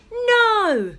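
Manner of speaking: high, loud urgent cry; father's alarmed shout at the fur ball. Acted. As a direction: call her to look, alarmed. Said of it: "said Father".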